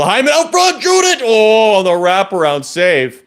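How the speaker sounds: rising shout as chance builds; excited play-by-play. Real, not acted. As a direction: call the near goal with a yell. Near goal, excited play-by-play.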